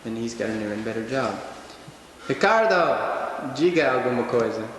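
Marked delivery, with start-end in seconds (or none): 2.3-4.7 loud call across the room. Real, not acted. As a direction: shout a name to get attention. Calling out to Ricardo.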